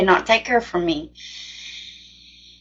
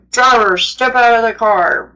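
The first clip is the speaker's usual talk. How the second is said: retold police order, projected slightly louder; imitated bullhorn command; 4 dB louder than usual. Cop orders driver out at traffic stop, imitated bullhorn command. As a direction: mimic a cop calling out over a bullhorn. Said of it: "whatever they do to talk really loud".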